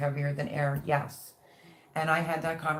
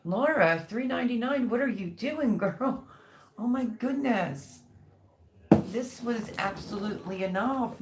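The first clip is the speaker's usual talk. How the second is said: excited thanks; 6 semitones higher than usual. Real, not acted. Thanking super chat donor excitedly.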